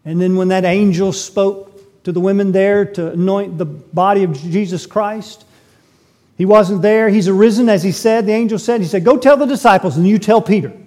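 rising preacher's fervor; fervent preaching. Acted. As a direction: proclaim it to the back pew. Preaching the resurrection at full force.

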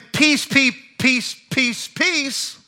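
David's gentle side, lightly mimicked; mock gentle peace. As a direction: coo "peace" softly like a peacemaker. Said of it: "he went from saying".

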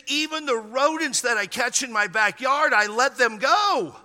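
high-pitched retold self-defence; earnest insistence. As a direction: imitate him insisting, stretching the last words. Imitates man insisting on his innocence.

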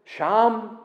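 "sham" said softly as the Hebrew word, "shahm". Shown as a demonstration. Preacher gives the Hebrew word for there, softly. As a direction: give the Hebrew "sham" a clean short a. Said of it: "Putting in this Hebrew word".

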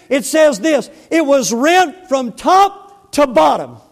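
slow, punched-out pulpit proclamation; forceful preaching. Real, not acted. Preacher proclaiming the torn veil to the congregation, forceful. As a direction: proclaim it, stretching the key words. Preacher builds to doctrinal climax.

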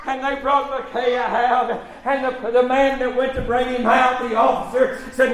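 ordinary talk, nothing marked

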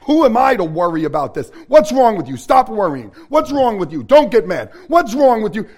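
loud, harsh scolding of himself; self-scolding. Retold or imitated. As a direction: bark at yourself for worrying.

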